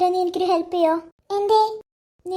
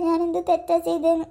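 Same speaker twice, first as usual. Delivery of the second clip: tearful, subdued and lost; helpless, close to sobbing; crying; 3 semitones lower than usual. Acted. Girl wondering what wrong she did, crying. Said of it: "നീ കരയല്ലേ".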